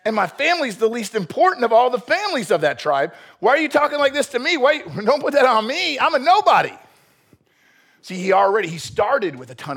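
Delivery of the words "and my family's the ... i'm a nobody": high, self-pitying whine; whining protest. Acted. Saul refuses Samuel's call to be king, whining in protest. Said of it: "he's still whining about being a nobody".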